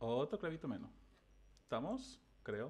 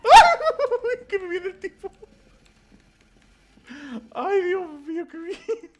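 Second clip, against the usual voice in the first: high shrieking panic with nervous laughter; panicked screaming; 20 dB louder, slower than usual. Real, not acted. Gamer chased by the creature, shrieking half-laughing panic. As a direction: shriek as the thing chases you. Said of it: "Perdón por gritar así".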